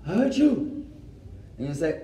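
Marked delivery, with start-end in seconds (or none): 0.1-0.8 a quick, higher pretend sneeze; imitated sneeze. Shown as a demonstration. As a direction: act out a sneeze. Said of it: "You sneeze".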